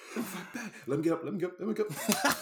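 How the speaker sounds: high, laughing cries for release; loud high-pitched hammed-up pleading through laughs; mock struggling plea. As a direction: play pinned down, breaking into laughter. Acting out pinned victim, laughing.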